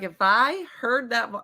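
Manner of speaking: ordinary delivery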